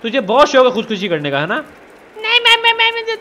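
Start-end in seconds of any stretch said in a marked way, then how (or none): 2.2-3.2 high squeaky pet-like voice, pitched well up; Pappu voice. Pretend. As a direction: play the little creature whining. Voicing Pappu the game character. (Pappu की ऊँची पतली आवाज़)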